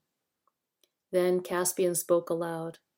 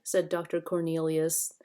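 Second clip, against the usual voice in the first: usual speaking voice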